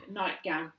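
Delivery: ordinary delivery, nothing marked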